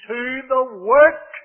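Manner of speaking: slow stress on work; emphasis on work. Real, not acted. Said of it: "note the emphasis there".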